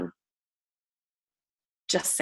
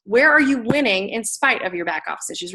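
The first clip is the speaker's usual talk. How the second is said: ordinary delivery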